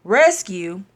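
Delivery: ordinary delivery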